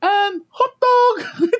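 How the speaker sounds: very high, slow sing-song announcer; train announcer. Retold or imitated. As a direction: drag out the menu like the attendant. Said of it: "she'd be like".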